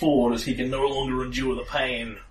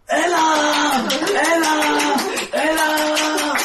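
high, loud drawn-out chant; crowd's victory shout; 14 semitones higher, 4 dB louder, much slower than usual. Acted. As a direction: cheer like a triumphant crowd. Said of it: "They're shouting functionally".

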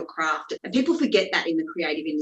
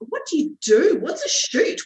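high, loud mock-panicked questions; panicked bewilderment; 11 semitones higher, 6 dB louder than usual. Novice offered a shoot tomorrow, panicked bewilderment. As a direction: retell it as a squeal of total cluelessness.

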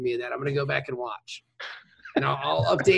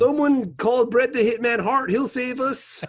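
high, loud hammed-up Canadian alarm; broad joke Canadian accent; mock Canadian; 8 semitones higher, 5 dB louder than usual. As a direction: play a panicked Canadian in a comic skit. Mock Canadian zombie panic bit.

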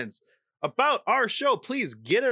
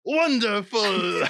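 slow, relishing French cabaret host; French accent; 4 semitones higher, 3 dB louder, much slower than usual. Acted. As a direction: stretch it out in mock French. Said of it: "it's your best one".

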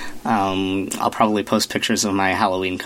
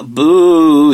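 mock spooky boo; 5 semitones higher, 7 dB louder than usual. Spooky sign-off, loud boo.

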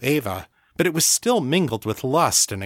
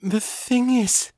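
higher, slow halting start; struggling to get it out; stammering; 11 semitones higher, slower than usual. A narrator's reading. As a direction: Ava stammering a warning. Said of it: "Ava stammered".

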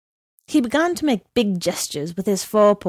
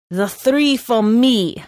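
majestic claim; 3 semitones higher, 4 dB louder, slower than usual. A narrator's reading. Horse claims drinks majestically.

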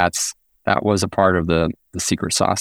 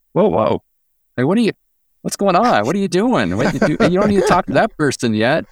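alarmed colleagues; 11 semitones higher than usual. Retold or imitated. Colleagues balking at pushy partner outreach, high startled mock alarm. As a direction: mimic partners caught off guard and objecting. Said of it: "everyone's like".

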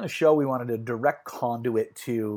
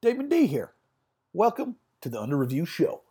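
put-on little southern accent; 5 semitones higher than usual. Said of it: "Like my little, my little southern accent there".

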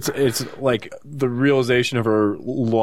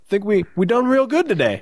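high Missouri twang; 10 semitones higher than usual. Retold or imitated. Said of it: "You're like Proxy Missouri for me".

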